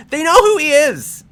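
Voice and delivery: high, loud furious rant; real anger. Real, not acted. Vlogger ranting at the hockey players, furious and loud. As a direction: let the outrage boil over. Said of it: "But I'm angry".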